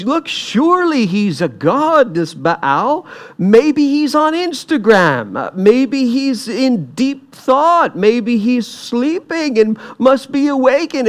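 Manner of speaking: high-pitched mocking suggestions; taunting. Elijah mocking the prophets about their absent god, taunting. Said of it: "he's taunting them".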